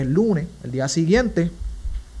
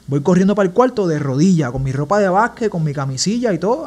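normal talking voice

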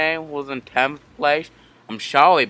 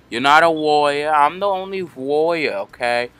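normal talking voice

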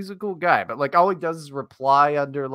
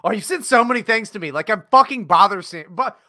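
higher, louder, building to a shout; mock indignant rant; 7 semitones higher, 7 dB louder than usual. Friend griping at co-host over sent videos, loudly indignant. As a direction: play up the hurt outrage.

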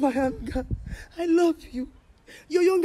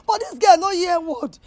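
loud complaint; 5 semitones higher, 8 dB louder than usual. Real, not acted. Mother complaining loudly about daughter.

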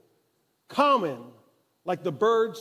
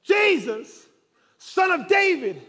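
desperate shout; 6 semitones higher, 7 dB louder than usual. Acted. Blind man crying out to Jesus over the crowd, loud and high. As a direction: shout it out, stretched and pleading. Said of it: "And he says".

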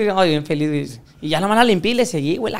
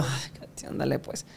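breathy unvoiced sigh of giving in; barely audible reluctant okay; resigned whisper; 4 semitones lower, 14 dB quieter, much slower than usual. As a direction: whisper it, defeated. Reluctant whispered reply in story.

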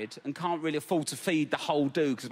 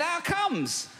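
incredulous. Retold or imitated. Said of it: "I said".

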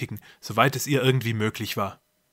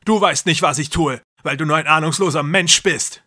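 higher, louder voice of a brother lost in religious delusion; loud and grandiose, playing a god; deranged brother; 5 semitones higher, 7 dB louder than usual. A narrator's reading. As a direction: brother lecturing his trapped sister. Jakob voiced in story.

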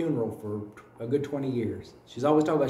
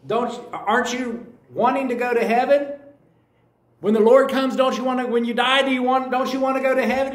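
pressing preacher; 8 semitones higher, 5 dB louder than usual. Retold or imitated. Preacher pressing the little boy.

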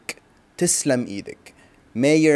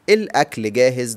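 quick teaching repeat; much faster than usual. Shown as a demonstration. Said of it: "the food is ready".

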